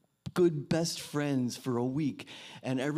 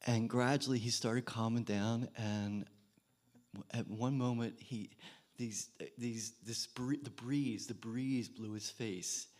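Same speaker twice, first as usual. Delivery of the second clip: usual speaking voice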